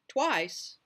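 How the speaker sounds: sharp, loud, high one-word question; jolted by the detail, voice jumps up; startled. A narrator's reading. Stormy startled to hear Elvis squeezed twice, loud and sharp.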